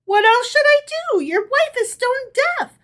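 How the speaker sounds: very high, strained croak, fast. A narrator's reading. Herschel's wife to rabbi, hoarse croaking. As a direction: croak it with a ruined voice. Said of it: "croaked Herschel's wife".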